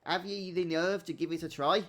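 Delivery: put-on a mild pirate accent, rolling and hearty. Pirate innkeeper tempting guests, in a mild pirate accent. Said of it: "my best pirate accent there".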